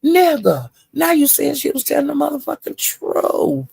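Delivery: high mocking callout of a liar; high exasperated callout. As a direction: call him out in a high incredulous voice. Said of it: "And I was saying".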